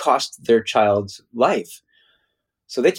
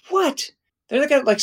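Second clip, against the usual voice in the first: amazed disbelief. Retold or imitated. Visitor reacting to Kalahari kids' awareness, high-pitched amazed disbelief. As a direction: voice leaps very high in retold astonishment.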